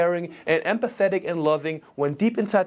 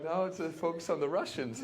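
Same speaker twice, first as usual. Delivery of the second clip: normal talking voice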